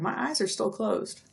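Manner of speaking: normal talking voice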